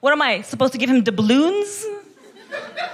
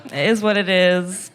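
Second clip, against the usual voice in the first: ordinary delivery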